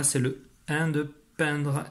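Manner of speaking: "c'est le un de peindre" slowly, un held. Shown as a demonstration. Correcting a pupil's spelling, slow and clear. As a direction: lean on "peindre" so the vowel is clear. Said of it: "c'est pas ce que j'ai demandé".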